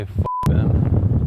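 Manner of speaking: blurted loud and forceful; loud, punchy outburst; loud curse. Shown as a demonstration. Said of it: "Or no, sorry, not".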